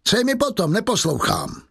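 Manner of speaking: high-pitched brusque refusal; narrator's irritated Javert; angry dismissal. A narrator's reading. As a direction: brush him off, pitch leaping up.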